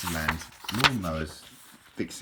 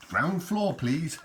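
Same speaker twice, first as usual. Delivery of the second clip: high, louder mock lift announcement; lift announcer; 9 semitones higher, 5 dB louder, much slower than usual. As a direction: announce it like a lift voice, tongue in cheek. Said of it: "Like in a lift, you know".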